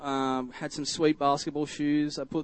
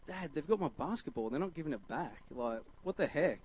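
lightly voiced kid complaining to Dad; softer, mock-indignant young complaint; childish complaint; 9 dB quieter than usual. Child complains to dad about ball.

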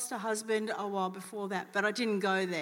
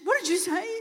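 startled question; 8 semitones higher, 6 dB louder than usual. Retold or imitated. Retelling lady's startled reply.

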